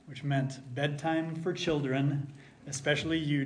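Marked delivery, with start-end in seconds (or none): none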